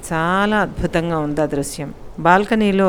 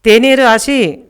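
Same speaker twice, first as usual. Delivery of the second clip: loud, high call across the house; shouting for tea; 6 semitones higher, 9 dB louder, slower than usual. Acted. Husband yelling for his wife to bring tea, loud high call. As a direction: holler for her to bring tea. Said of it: "అని అరుస్తాడు".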